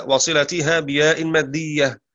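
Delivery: ordinary talk, nothing marked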